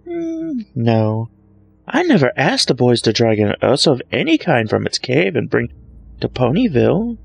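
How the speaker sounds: high, showy taunting voice; boastful showmare mocking Twilight; smug Trixie. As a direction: gloat over her rival. Trixie gloats.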